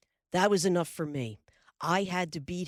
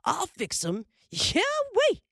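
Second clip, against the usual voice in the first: screeching wildcat yell, much higher and louder; 6 dB louder than usual. Acted. Mister Edwards screeching as he jumps the Huron man. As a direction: let out a wild catamount screech. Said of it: "he yelled".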